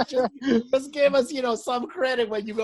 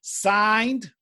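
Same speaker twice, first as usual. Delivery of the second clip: slow letter reading; 4 semitones lower, much slower than usual. Acted.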